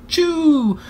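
high sneeze blast. Acted. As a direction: sneeze it out hard. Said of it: "Then we heard".

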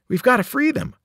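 earnest eager Grover. A narrator's reading. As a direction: insist on freeing them. Grover urgent.

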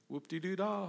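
soft, sarcastic whoop-de-doo; lightly sarcastic scoff; sarcastic dismissal. Acted. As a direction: brush it off with mock cheer.

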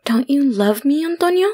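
put-on high, rising little-girl plea; pouty pleading. A narrator's reading. Stalker begging the terrified man for love, pouty pleading. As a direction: plead through a pout, climbing high at the end. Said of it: "pouting my lip".

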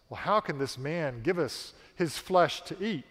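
ordinary talk, nothing marked

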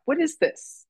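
high-pitched, puzzled question; baffled surprise. Real, not acted. Speaker spots emojis popping up mid-call, baffled surprise. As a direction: ask it in real bewilderment, voice jumping up.

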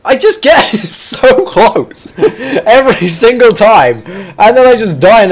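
high, drawn-out, laughing lament; laughing frustration. Real, not acted. Gamer always dying just short, laughing frustration. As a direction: wail it slowly through laughter.

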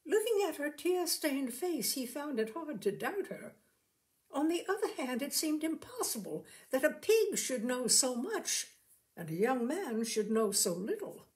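plain reading voice

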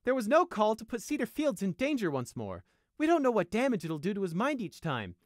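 high, loud indignant scolding; angry. A narrator's reading. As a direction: berate him for endangering someone. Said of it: "Skyflight asked Prey angrily".

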